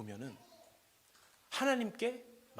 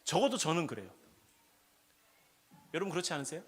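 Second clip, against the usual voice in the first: ordinary talk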